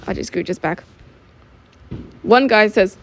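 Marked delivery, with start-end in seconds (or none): none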